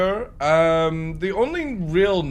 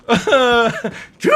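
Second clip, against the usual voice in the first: put-on old lady farewell; 11 dB louder, much slower than usual. Mrs Doubtfire farewell.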